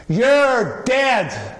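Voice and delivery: high, loud yelled threat; shout. Retold or imitated. Passenger in first class yelling over and over, high loud shout. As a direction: bellow it across the cabin.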